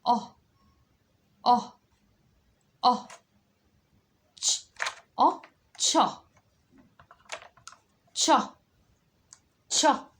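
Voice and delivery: loud, clipped phonics sounds read off the board; sound drills. Shown as a demonstration. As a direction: bark each letter sound to the class. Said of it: "اللي بعده".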